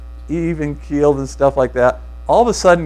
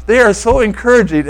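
imitating a giddy friend, higher pitched; giddy big smile; 6 semitones higher, 3 dB louder than usual. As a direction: gush through a big grin. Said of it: "he got this big smile".

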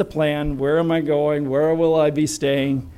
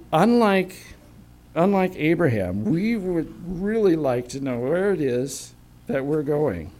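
ordinary talk

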